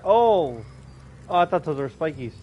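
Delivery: ordinary talk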